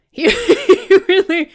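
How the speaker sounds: laughing through the words. Real, not acted. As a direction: break into a laugh mid-sentence. Amused retelling.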